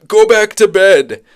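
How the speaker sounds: put-on loud, high, drawn-out old man order; old man calling out, slow and loud; Eli/character. Old man sends the child away again, loud old man voice. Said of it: "he tells me again".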